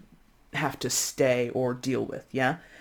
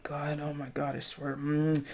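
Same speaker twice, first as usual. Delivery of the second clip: soft frustrated muttering under your breath; 8 dB quieter than usual. Pretend. Caller on hold with the bank, muttering under breath. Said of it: "muttering under your breath".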